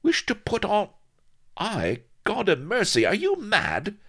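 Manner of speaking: very high, loud incredulous echo; aghast indignation. A narrator's reading. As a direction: splutter it back in outrage. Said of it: "It chopped her anger across the windpipe".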